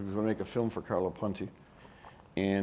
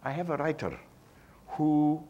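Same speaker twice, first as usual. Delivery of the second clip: put-on Antonioni's Italian accent; 6 semitones higher than usual. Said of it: "I'm not gonna do his accent".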